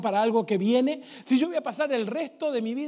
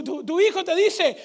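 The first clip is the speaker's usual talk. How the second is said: ordinary delivery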